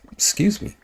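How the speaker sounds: half hiccuping, half burping. Real, not acted. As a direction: excuse me through a hiccup. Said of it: "Like half hiccuping, half burping".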